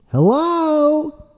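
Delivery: loud, high-pitched attention call; insistent calling. Pretend. Demanding Mister Dude answer, high insistent call.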